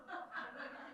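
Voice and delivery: quiet, high chuckle; laughing. Real, not acted. Preacher amused at his own joke, soft high laugh. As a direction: chuckle at your own punchline.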